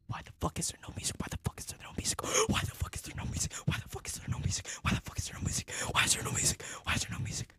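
fast, urgent whisper on repeat; nervous whispering. Real, not acted. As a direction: whisper it over and over in a hurry. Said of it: "why am I whispering?".

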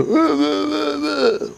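put-on high, very slow quavering old man. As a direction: drag it out like a creaky old man. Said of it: "old man voice".

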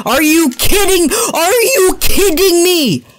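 can't believe he just died; outraged disbelief. Real, not acted. Gamer dies suddenly, outraged high disbelief. Killed unexpectedly in game.

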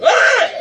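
loud high yelp; sudden loud cry of frustration; startled scream. Real, not acted. Startled by death in game.

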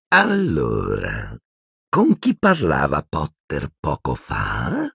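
very slow, drawn-out villainess; menacing interrogator. Acted. Umbridge questioning Hermione about Potter, slow menacing drawl. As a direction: open low, draw out the question.

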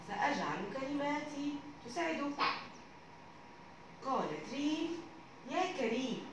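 put-on reading a children's story aloud; calm storybook narration for kids. A narrator's reading. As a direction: tell the tale gently to young pupils.

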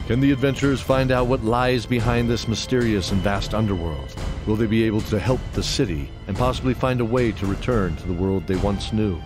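ordinary talk

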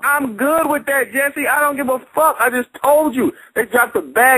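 high and unbothered, defiant. Real, not acted. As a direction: brush it off, defiant. Heated argument, defiant.

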